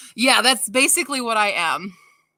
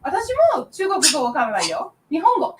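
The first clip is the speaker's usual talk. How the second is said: brisk, higher teasing Japanese; playful Japanese; 6 semitones higher, 5 dB louder, faster than usual. Real, not acted. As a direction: tease back quickly in Japanese. Answers child in Japanese.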